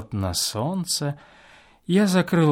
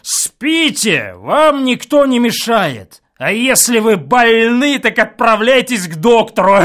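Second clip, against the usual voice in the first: loud, higher angry reply; angry retort; 8 semitones higher, 9 dB louder than usual. A narrator's reading. Guest shouts back at the neighbour, angry. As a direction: snap back at him. Angry quarrel through wall.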